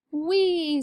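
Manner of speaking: gleeful whee. A narrator's reading. Amelia Bedelia plays with the flying bonnet, gleeful squeal. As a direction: let out a high, playful whee.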